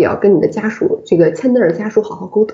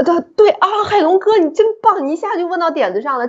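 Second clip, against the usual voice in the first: much higher, eager praise; light up, he nailed the point; excited praise; 9 semitones higher than usual. Real, not acted. Host excitedly praises Hailong for his sharp question, pitched high.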